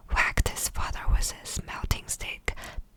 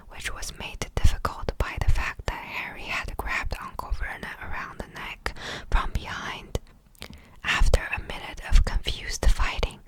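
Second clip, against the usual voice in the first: plain reading voice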